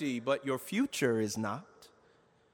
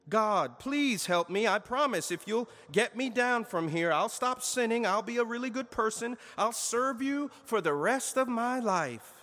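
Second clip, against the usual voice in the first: pleading; 8 semitones higher than usual. A narrator's reading. Jack begging God on cliff.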